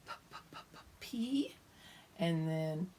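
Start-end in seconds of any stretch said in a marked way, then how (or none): 0.1-1.5 "P" as voiceless puh bursts; p sound. Shown as a demonstration. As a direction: pop each "P". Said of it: "And P".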